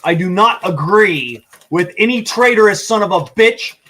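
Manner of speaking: ordinary talk, nothing marked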